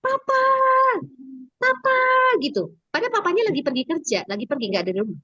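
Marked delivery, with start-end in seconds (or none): 0.0-2.0 high-pitched imitated kid calling out; mock yell of a small boy; retold child's yelling. Boy calling for his absent dad, high mock yell. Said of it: "dia teriak-teriak".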